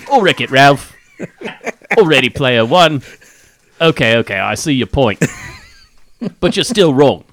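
put-on Aussie accent; Australian accent. Reading Aussie listener's mail on a podcast, mock Australian accent. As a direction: read it as a hammed-up Australian. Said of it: "read it in an Australian accent".

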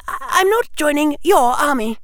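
put-on high, fast, annoyed girl; sharp. Hermione refusing Harry's army, sharp. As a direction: cut him off in a high, loud voice. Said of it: "Hermione's voice was sharp".

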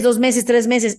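ordinary talk, nothing marked

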